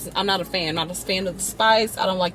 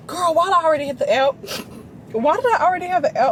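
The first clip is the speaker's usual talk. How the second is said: surprised; 8 semitones higher, faster than usual. Real, not acted. Realizes she already has app.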